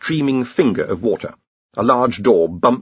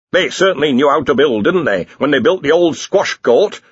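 put-on admiring old academic, pitched up; 4 semitones higher, 4 dB louder than usual. A narrator's reading. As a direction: marvel at the old workmanship. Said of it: "in an admiring tone of voice".

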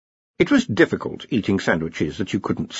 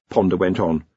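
ordinary delivery

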